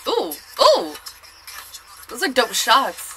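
ordinary talk

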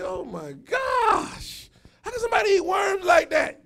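high-pitched, drawn-out astonishment; hammed-up shocked outcry; mock horrified disbelief. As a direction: exclaim it high, aghast at what you saw. Retells shock at worm eating.

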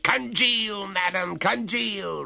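high-pitched cold dismissal; mock her plea without pity. A narrator's reading. Oglethorpe answers the freezing ghost, coldly. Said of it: "returned Oglethorpe coldly".

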